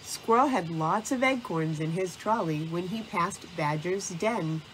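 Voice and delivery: ordinary delivery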